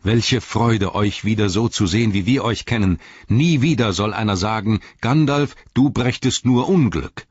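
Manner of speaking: overflowing with joy at the king's return; joyful. Acted. Eomer greeting his renewed king, elated and loud.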